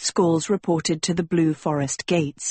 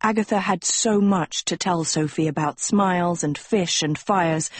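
plain reading voice

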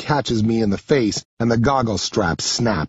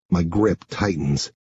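usual speaking voice

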